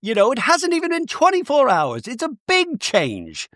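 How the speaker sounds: high chirpy voice; high Bronwyn voice. Retold or imitated. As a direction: pitch it up bright and chirpy. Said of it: "But Bronwyn's like".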